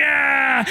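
high, drawn-out cry of frustration; comic wordless yell of rage; mock cursing yell. Pastor acting out his rage over a crushed thumb, mock yell.

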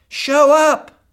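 high, loud exhortation; shouted call. Real, not acted. Preacher calling husbands to show up, loud.

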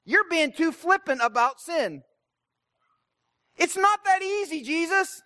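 mock exasperated religious officials; frustrated. Religious teachers scolding Jesus over procedure, frustrated. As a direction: protest in mounting exasperation, as a stickler for rules. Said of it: "they're frustrated at Jesus 'cause they're like".

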